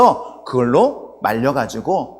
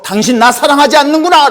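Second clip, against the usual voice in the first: high, loud, hurt accusation; acted angry outburst; 12 semitones higher, 11 dB louder than usual. Preacher voices weeping Delilah accusing Samson, furious. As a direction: burst out as a wronged lover in mock fury. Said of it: "심각하게 화를 내면서".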